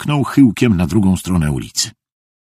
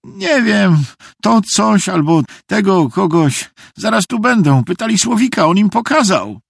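put-on high, agitated boy's voice; panicked pleading; 12 semitones higher than usual. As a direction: blurt it out urgently, held high throughout. Panicked boy explains the danger. (spanikowany, podniesiony głos chłopca)

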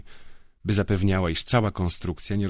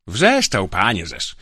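put-on drunken bragging; 2 semitones higher, 7 dB louder, slower than usual. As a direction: gloat drunkenly about his yelling.

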